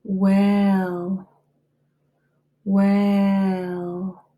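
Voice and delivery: "Well" held out slowly and clearly; careful model word. Shown as a demonstration. Teacher modelling the word "Well" for students, slow and clear. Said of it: "Your word is well".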